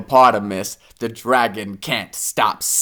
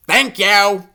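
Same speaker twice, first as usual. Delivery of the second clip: badger thanks; 7 semitones higher, 4 dB louder than usual. A narrator's reading. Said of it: "the honey badger says".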